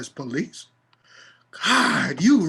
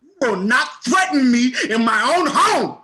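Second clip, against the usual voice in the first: pitched up and louder, raging; furious shout; 9 semitones higher, 6 dB louder than usual. Acted. Man defies the officer holding a gun, raging shout. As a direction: yell it at the cop in your face.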